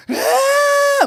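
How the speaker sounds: loud, high imitation crying wail; long high mock sob; siren-like wailing. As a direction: mimic bawling like a siren. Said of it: "사이렌을 울리면서 기도를".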